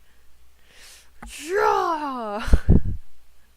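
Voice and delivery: loud, long imitated war cry; Viking battle yell. Mocking a game character's attack move, loud Viking yell. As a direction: mimic a Viking attack roar. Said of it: "Bro sounds like a Viking".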